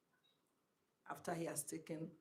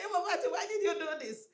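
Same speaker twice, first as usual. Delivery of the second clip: guru's startled cry; 13 semitones higher, 9 dB louder, faster than usual. Retold or imitated. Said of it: "And he said".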